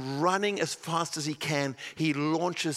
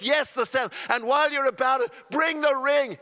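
high, loud insistent call; father calling out; 8 semitones higher, 4 dB louder than usual. Acted. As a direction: override their objection with joy.

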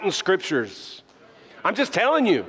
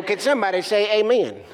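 put-on country preacher accent; 3 semitones higher than usual. Imitating first pastor asking for amens, country accent. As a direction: do an old-school country preacher. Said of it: "he's talked like this".

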